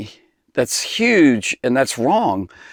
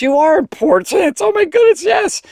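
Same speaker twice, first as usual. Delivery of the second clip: emphatic reassurance. Real, not acted. Preacher assuring the teens they are important, much higher and excited. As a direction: leap up in pitch to insist they matter.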